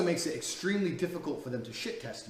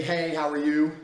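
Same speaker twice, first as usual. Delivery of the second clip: louder, bright mock greeting from a typical guy; eager greeting; 7 dB louder, slower than usual. Men messaging a girl on Instagram, louder mock eager hello. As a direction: play the keen guy opening a chat. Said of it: "a lot of men say".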